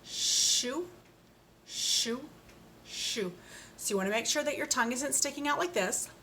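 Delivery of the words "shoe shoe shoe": shoe with a long sh, first higher and rising; teacher repeating a word aloud; shoe drilled three times. Shown as a demonstration. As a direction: drill shoe slowly for the class. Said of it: "Let's try that three times".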